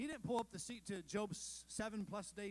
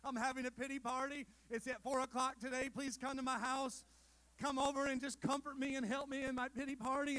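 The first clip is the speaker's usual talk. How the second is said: hammed-up, higher and louder invitation to a pity party; mock self-pitying host; 5 semitones higher, 5 dB louder than usual. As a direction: plead for comfort in a mock whiny voice. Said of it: "You want everybody to know".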